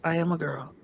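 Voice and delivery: ordinary delivery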